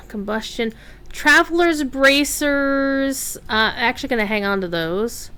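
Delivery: normal talking voice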